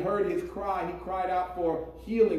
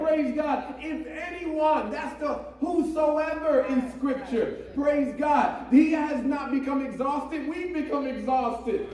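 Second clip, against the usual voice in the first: raised, emphatic pulpit voice; impassioned preaching; 5 semitones higher than usual. Real, not acted. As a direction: preach it with fervor to the congregation. Preaches at full force.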